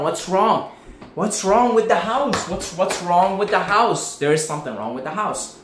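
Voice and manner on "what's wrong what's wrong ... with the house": panicked. Pretend. Boy trapped in the house, calling out in panic. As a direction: cry out in alarm at the noises.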